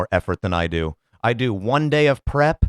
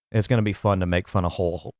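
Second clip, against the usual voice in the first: ordinary talk, nothing marked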